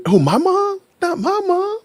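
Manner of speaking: high falsetto mock shock; mock disbelief. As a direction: play scandalized, voice shooting way up. Mock shock about own mother.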